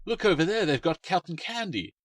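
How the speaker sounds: put-on high, loud childish shout; a boy shouting over the crowd to his sister; excited yell. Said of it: "yelled at the top of his voice".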